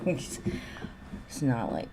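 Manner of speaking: breathy laughing restart, very slow. Real, not acted. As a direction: laugh the words out, barely voiced.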